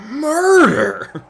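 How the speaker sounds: high, loud, drawn-out mock cry; over-the-top preacher mimicry; Stephen Lett impression. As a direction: blow the word up with absurd melodrama. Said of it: "we just have Stephen Lett saying".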